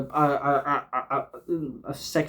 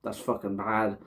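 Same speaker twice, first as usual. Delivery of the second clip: mock disdain; 6 semitones lower, 11 dB quieter than usual. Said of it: "they're like".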